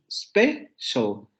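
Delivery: special split into spe, pause, shal; two syllables. Shown as a demonstration. Teacher showing the English syllables, split spe-shal. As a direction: say special in two beats, starting on S. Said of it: "la primera sílaba empieza con la S".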